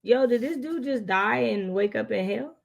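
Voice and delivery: usual speaking voice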